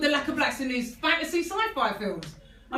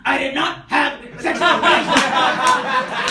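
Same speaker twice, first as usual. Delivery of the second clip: loud, very slow mock denial; Bill Clinton; 7 dB louder, much slower than usual. Comic imagining Cosby as the president, slow loud mock denial. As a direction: drag out the famous denial. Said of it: "Bill Cosby playing Bill Clinton".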